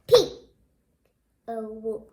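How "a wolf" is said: ordinary delivery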